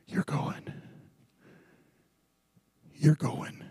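breathy whispered warning; ominous whispered reminder. Shown as a demonstration. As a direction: whisper it like a secret dread. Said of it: "repeated for effect".

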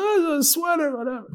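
high, airy brush-off; faked casual. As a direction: act like it's nothing. Guy caught, covers casually.

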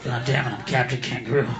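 low, sour self-disgust; disgusted Captain Kangaroo. Retold or imitated. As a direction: imitate a disillusioned performer cursing his lot. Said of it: "He looked like a heavy fucking drinker".

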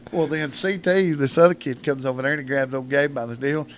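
usual speaking voice

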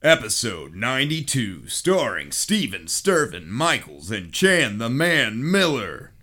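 loud, big show intro; announcer voice. Acted. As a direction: boom it out like a host.

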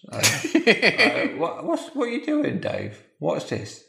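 very high wife voice, giggling through it; the wife flustered and amused; laughing wife. Acted. Wife puzzled by husband.

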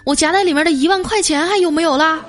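high, urgent mock panic; panicked. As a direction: urge her anxiously in a high voice. Husband panics over hidden cash.

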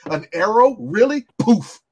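mock Superman scoff. Friend voicing Superman swatting an arrow, mock scoff. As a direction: toss off the arrow like it's nothing.

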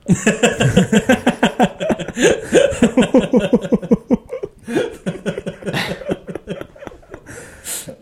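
long, high wheezing laughter; real uncontrollable giggling; helpless laughing fit. Real, not acted. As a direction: crack up and keep going until breathless. Cracks up over nose joke.

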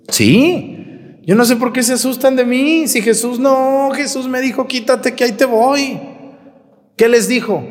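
high, animated protest; excited. Real, not acted. Priest defending his blunt style to the congregation, high and excited. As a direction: pitch it up with lively outrage.

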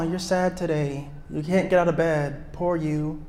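mock pity. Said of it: "Sympathy says".

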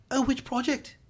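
high, lightly imitated British query; British accent. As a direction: mimic a polite British developer asking. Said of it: "British accent".

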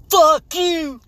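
high, loud drawn-out yell; acted outrage. Host acts co-host raging at his sunburn, angry yell. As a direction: explode in a hammed-up furious shout. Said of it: "You're like".

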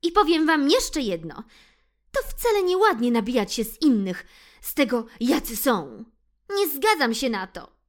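high, loud lecturing mouse; scolding mouse. Acted. Ixi lectures the cats on teasing, high and loud. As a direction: tell the cats off firmly. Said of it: "powiedziała".

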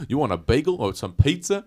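loud, high mock New Yorker; New York accent. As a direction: hawk it like a street vendor. Joining the accent bit.

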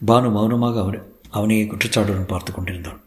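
plain reading voice

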